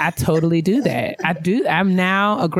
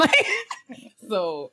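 ordinary talk, nothing marked

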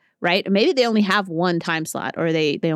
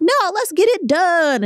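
much higher, slow, a little louder pony voice; Rainbow Dash; 12 semitones higher, 4 dB louder, slower than usual. Retold or imitated. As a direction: push to finish in a pitched-up cartoon voice. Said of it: "And then Rainbow Dash is like".

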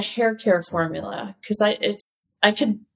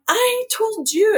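mock German accent, pitched way up and slowed; 12 semitones higher than usual. Horst scolding the chemist, high mock German accent. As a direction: play the angry German boss scolding in a put-on German accent. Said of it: "like, a German accent".

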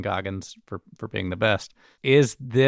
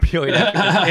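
higher, louder, through laughter; laughing repeat; 8 semitones higher, 6 dB louder, faster than usual. Real, not acted. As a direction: echo the joke while cracking up.

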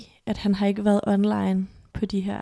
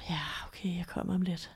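grudging, whispered, much softer; reluctant mumble; 2 semitones lower, 10 dB quieter than usual. Retold or imitated. Boyfriend grudgingly agrees to come over, soft reluctant mumble. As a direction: mumble it like you can't be bothered.

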